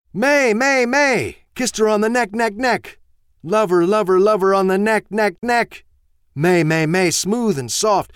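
put-on very high, loud, bouncing boy chant; giddy excited child. Ben dancing in the kitchen, squealing with glee. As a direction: squeal it like an overjoyed kid.

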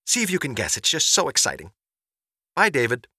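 chatty excited mother. Acted. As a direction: bubble over with news for your son. Mother's answering-machine message.

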